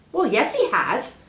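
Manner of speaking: put-on a worried kid asking for help; young boy Peter. Boy asks the snake about the scroll, eager.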